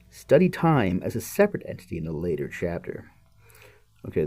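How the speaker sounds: ordinary talk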